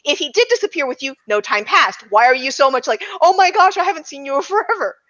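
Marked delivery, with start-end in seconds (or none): none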